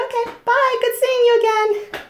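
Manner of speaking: high, singsong breezy farewell; chirpy goodbye. Acted. Leaving the tied-up man for work, chirpy and high. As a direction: toss off a bright, high-pitched bye.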